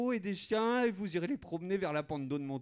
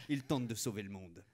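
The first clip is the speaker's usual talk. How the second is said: usual speaking voice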